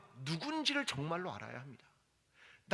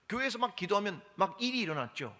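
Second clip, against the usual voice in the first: ordinary talk, nothing marked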